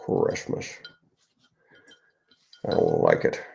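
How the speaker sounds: whispered sour Grinch line, then low; Grinch impression. The author repeating the Grinch for viewers. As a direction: breathe it out like a sulky Grinch. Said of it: "And I nailed it".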